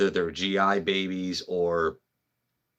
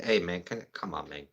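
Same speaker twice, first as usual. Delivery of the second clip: put-on Mang accent; 6 dB quieter than usual. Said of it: "my Mang accent".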